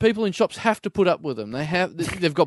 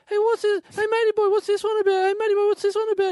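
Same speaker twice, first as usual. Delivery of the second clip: high nasal-free squeaky pester, much higher than his own voice; pestering weirdo customer; faster than usual. Retold or imitated. Comic shop weirdo pointing at every comic, high pestering voice. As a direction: needle the shop guy in a high silly voice. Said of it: "and he goes".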